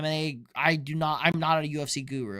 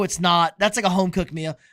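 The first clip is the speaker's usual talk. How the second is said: ordinary talk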